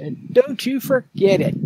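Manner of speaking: high-pitched playful echo; mock warning. Host playfully echoing the co-host's catchphrase, high mock warning.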